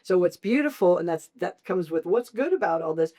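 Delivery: usual speaking voice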